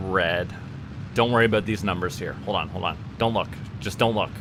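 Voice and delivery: usual speaking voice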